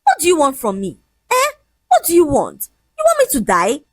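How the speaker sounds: high-pitched confronting questions; exasperated high challenge; heated demand. A narrator's reading. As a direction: demand answers, pitch pushed up. Heated lovers' quarrel.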